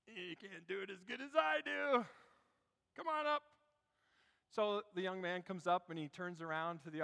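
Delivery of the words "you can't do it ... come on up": higher, mock-confident stage thought; actor's scornful inner thought. Actor privately doubting the young volunteer, mock superior. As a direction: privately dismiss a cocky challenger, pitched up. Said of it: "'Cause really he's thinking".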